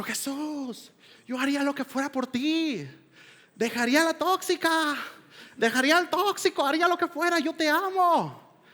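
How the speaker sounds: a high, rising, gushing promise; fervent vow. Retold or imitated. Worshipper vowing everything to Jesus after praise, high gushing zeal. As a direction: swear it all with worship-night zeal.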